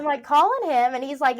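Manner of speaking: ordinary delivery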